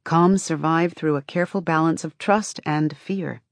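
ordinary delivery

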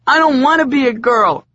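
put-on high, loud boy protesting; a boy refusing the girl part; whiny boy. A narrator's reading.

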